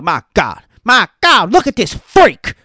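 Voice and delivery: high, loud, excited play-by-play imitation; hammed-up old-school commentator awe; Jim Ross impression. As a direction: call it like a legendary wrestling announcer losing it. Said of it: "He'd be like".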